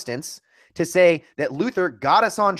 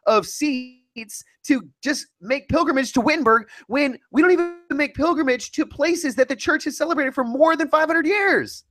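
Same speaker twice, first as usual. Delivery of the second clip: fired-up raised voice; heated rant; 9 semitones higher than usual. Real, not acted. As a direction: rant about it, pitch up.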